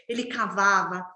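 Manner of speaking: usual speaking voice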